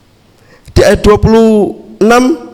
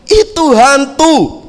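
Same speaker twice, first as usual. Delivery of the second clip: startled disciples; 6 semitones higher, 3 dB louder, much slower than usual. Retold or imitated. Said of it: "murid-muridnya langsung spontan mengatakan apa?".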